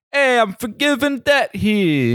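high, slow Noo Yawk "hey"; New York accent. Pretend. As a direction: call out like a street New Yorker. Mock New Yorker voice.

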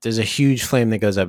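usual speaking voice

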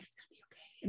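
barely audible imitated whisper; hushed reassurance. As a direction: mouth it almost without voice. Retold relative comforting on plane.

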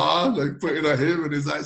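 ordinary talk

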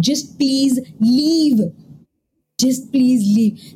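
slow, stretched imploring words; retold begging, each word held long; drawn-out plea. She begs the visitor to go, drawn-out plea.

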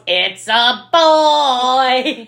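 loud, drawn-out high proclamation; hammed-up movie-villain announcement; mock triumphant announcement. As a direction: crow it out, stretching each word. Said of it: "turns around and goes".

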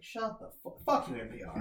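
low, soft muttered fuck you; quiet disgusted mutter at the radio; muttered curse. Retold or imitated. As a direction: grumble it under your breath.

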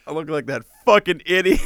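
teasing mock quote. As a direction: parrot the line through laughter. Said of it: "S1 repeats friend's line laughing".

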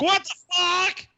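high, slow, stunned shriek; shocked yelp. Retold or imitated. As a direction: squeal it out in disbelief. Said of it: "He's like".

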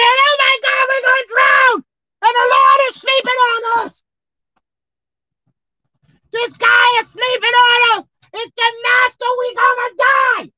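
high, loud panicky disciples; panicked shout. Acted. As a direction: yell as if about to drown.

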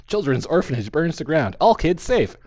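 loud, high announcer headline; dramatic headline read. Retold or imitated. Reading mock newspaper headlines.

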